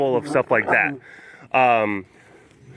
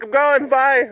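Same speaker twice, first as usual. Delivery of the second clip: laptop voice; 10 semitones higher, slower than usual. Pretend. Laptop voice leaving.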